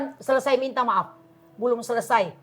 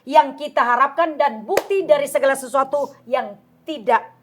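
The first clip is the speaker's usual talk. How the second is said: raised, forceful Bible verse; scripture recitation; 4 semitones higher, 6 dB louder than usual. Acted. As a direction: declare the verse firmly to the audience.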